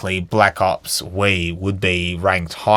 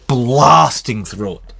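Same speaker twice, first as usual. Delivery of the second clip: ordinary talk, nothing marked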